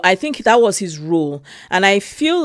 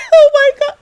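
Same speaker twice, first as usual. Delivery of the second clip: high, loud, laughing through tears; overcome, laughing and tearing up; real emotional laughter, much higher and louder; laugh-crying; 8 dB louder, faster than usual. Real, not acted. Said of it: "I started bawling".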